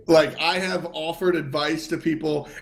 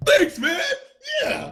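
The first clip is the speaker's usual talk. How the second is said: mock delighted thanks. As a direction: act out overjoyed, high-pitched thanks. Acts out happily taking the compliment.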